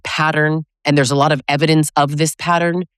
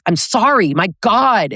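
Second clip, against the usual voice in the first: exasperated mock apology, higher; insincere apology; 5 semitones higher than usual. Partner apologizing without accountability, exasperated and insincere. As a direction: throw out sorry as irritated protest. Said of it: "let's say he did apologize".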